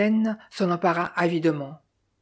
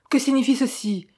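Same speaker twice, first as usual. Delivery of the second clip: higher-pitched, restrained outraged shout; mild narrated yell; 6 semitones higher than usual. A narrator's reading. Madden to Chan, who took the pearls, a mild yell. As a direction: read it as a contained yell of disbelief. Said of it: "hurla Madden".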